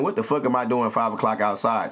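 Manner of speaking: ordinary talk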